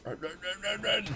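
rising frantic repeated run; frantic urging. Real, not acted. Gamer fleeing enemies, frantic rising urging. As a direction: hurry yourself along, panicking.

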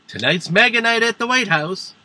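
high-pitched, hyped-up announcement; mock Trump announcement. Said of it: "Trump said".

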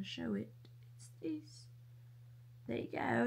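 soft whisper; hushed whisper. Real, not acted. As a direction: whisper it secretly.

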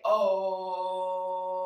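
low, held "Oh" with round lips; drawn-out oh. Shown as a demonstration. Teacher showing kids how to start om, held oh. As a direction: shape the mouth into an O and hold "Oh". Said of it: "Can you say".

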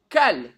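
"Kal" with an open A in place of O; "Kal" stretched, with a clear A vowel; pronounced Kal. Shown as a demonstration. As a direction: sound out "Kal" slowly, on its own. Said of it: "ça peut se prononcer".

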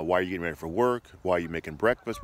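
ordinary delivery

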